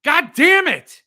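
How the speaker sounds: thrilled shout announcing a road trip; excited holler. Real, not acted. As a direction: yell it out to Jill like the trip is happening right now. Hollering about a New Orleans trip.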